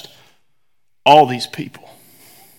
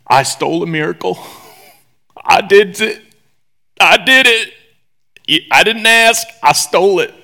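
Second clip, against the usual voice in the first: mock confession; 4 semitones higher, 4 dB louder than usual.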